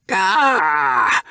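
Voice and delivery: high, gruff snarl of annoyance; snapping grunt. A narrator's reading. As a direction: snap back mid-scuffle with a grunt. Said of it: "snapped back".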